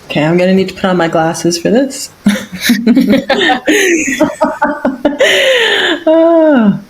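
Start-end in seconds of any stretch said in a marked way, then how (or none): 6.1-6.8 long high giggling laugh, easing into a sigh; real sustained laughter, pitched high; hearty laugh. Real, not acted. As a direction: laugh at yourself, then let out a high "ah". Laughs after remark about glasses.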